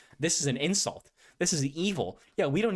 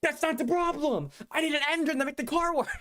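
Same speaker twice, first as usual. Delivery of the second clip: exasperated shout; 12 semitones higher than usual. Retold or imitated. Customer yelling at the car seller, exasperated. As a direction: yell it at the salesman, fed up. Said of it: "It's like".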